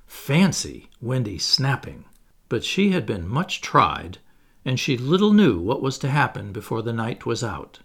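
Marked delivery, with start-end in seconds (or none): none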